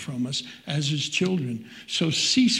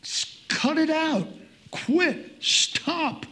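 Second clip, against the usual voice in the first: much higher, sharp scolding voice; God telling him off; 11 semitones higher, much slower than usual. Retold or imitated. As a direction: snap at him like an exasperated parent. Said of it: "God is saying".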